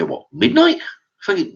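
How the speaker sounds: can't believe she means midnight; incredulous. Retold or imitated. Shock at the late hour.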